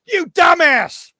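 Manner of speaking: high, loud incredulous yell; exasperated shout. Retold or imitated. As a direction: shout down a fool in mock outrage. Yelling comeback at Wolverine, laughter around.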